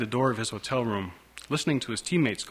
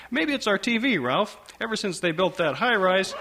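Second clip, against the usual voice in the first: mother voice; 8 semitones higher than usual. Acted.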